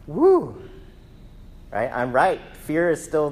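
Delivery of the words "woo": loud high whoop; excited whoop. Pretend. Preacher whooping about fear and excitement, loud high whoop. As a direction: let out a loud cheer.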